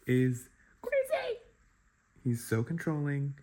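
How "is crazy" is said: high drawn-out squeal on crazy; sing-song crazy. Real, not acted. Reader venting about a fictional boyfriend, high drawn-out exclamation. Exasperated rant about Doug.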